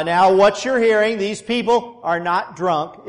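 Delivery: loud, preaching out over a big crowd; booming voice. Retold or imitated. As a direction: boom it out so the whole crowd hears. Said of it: "here's Peter in his booming voice".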